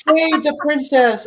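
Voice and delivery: high, loud mock hero cry; good-guy hero. Host playing the good guy for fun, high loud heroic cry. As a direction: call it out high, laughing, as a hero. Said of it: "I wanna be the good guy".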